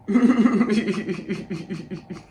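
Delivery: long run of real chuckles; amused laughter. Real, not acted. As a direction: laugh it out at your own story. Laughs at own story.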